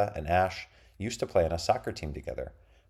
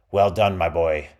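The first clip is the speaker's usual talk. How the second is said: pirate captain voice; 8 dB louder than usual. A narrator's reading. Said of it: "said Bluebriar".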